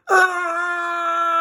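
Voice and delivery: high shrieking scream; falling scream. Acted. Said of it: "they screamed as they fell".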